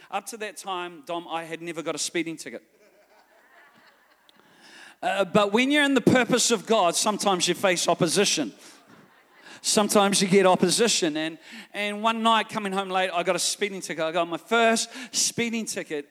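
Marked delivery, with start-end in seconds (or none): 5.0-16.1 loud emphatic preacher voice; preaching. Acted. Pastor to congregation, loud preaching. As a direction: press the point to the congregation.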